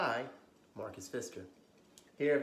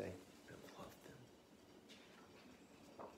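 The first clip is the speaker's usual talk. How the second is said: whispered aside; 4 semitones lower, 21 dB quieter than usual. Real, not acted. Soft aside to kids.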